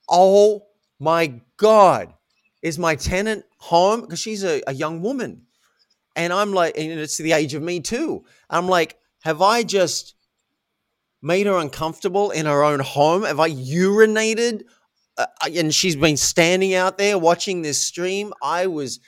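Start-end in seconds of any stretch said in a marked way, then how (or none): 0.1-4.1 loud, drawn-out horrified realization; aghast, reliving the shock. Retold or imitated. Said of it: "George, I was horrified". 9.2-14.6 horrified. Retold or imitated. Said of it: "I was horrified".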